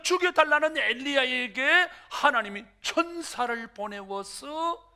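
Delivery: ordinary delivery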